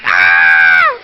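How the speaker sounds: loud imitated shriek of a scared girl; mock scream. Speaker imitating the frightened girl, loud mock scream. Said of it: "And she's like".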